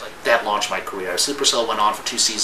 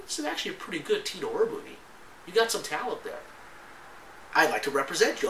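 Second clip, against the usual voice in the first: imitating the agent; 8 semitones higher, 6 dB quieter than usual. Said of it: "And he said".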